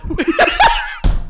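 loud breathy burst of laughter. Real, not acted. Laughs about wearing red.

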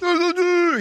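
high loud call across a room; yelling across the room. Retold or imitated. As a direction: shout a name across the room. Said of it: "Instead of".

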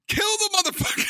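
yelling at the screen. Retold or imitated. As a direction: bark it at the movie like a fan. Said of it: "I'm like".

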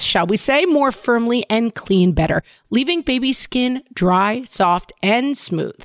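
upbeat sponsor read, loud and bright. Acted. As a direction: sell it like a radio ad.

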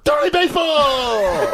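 drawn-out fan cheer, shouted high. Retold or imitated. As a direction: bellow his name like a fan in the stands.

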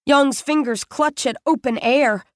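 ordinary delivery, nothing marked

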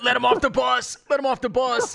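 fans yelling. Retold or imitated. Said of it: "These guys are like".

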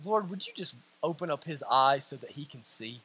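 low, hushed plea to God; quiet prayer. Acted. Elisha asking God to open his servant's eyes, hushed prayer. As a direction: pray it softly and low. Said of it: "he prays".

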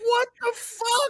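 high, slow, pained disbelief; disbelieving. Real, not acted. Reacting to the news, high and pained. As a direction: drag out what the fuck in shock.

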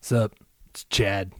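low, lazy dude-bro drawl; bro accent. Pretend. Calling the Wolves GM as a lazy bro. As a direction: go full Chad, slow and low. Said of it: "I had to go with that accent".

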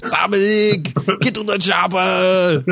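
full-out high Austrian action-hero yell; Arnold Schwarzenegger. Acted. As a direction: holler it with long, stretched vowels. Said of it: "five different Arnold movies now".

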